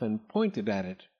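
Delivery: usual speaking voice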